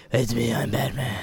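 rough, hushed superhero growl. Retold or imitated. Fan impersonating a film Batman, hushed growl.

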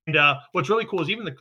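ordinary talk

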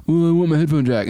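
whiny complaining impression; complainer voice. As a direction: play a grumpy complainer. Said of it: "your rant voice when you're doing an impression of someone complaining".

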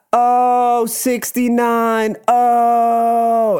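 very high, long drawn-out mock moan; drag hosts' call. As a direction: stretch every vowel in a high imitation. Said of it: "both of them just be like".

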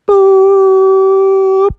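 long, high, loud boop; sustained electronic-style beep; imitated oven signal. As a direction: hold a tone like an oven timer. Imitates oven's ready signal.

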